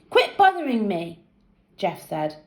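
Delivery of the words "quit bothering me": plain reading voice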